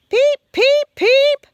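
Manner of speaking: very high, chirpy little peeps; frog peeping. A narrator's reading. Little frog calling through the woods, high chirpy peeps. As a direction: pipe it like a tiny spring frog.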